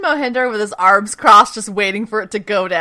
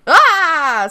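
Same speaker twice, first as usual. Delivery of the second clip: short high, loud mock scream; 8 semitones higher, 7 dB louder than usual. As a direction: let out a brief pretend scream.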